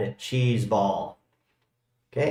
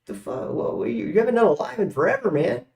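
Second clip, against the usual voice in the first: higher, fast mock of a friend's complaint; imitating Alan; 10 semitones higher than usual. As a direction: toss off his friend's nagging question, lightly mocked. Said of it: "Alan just asked me".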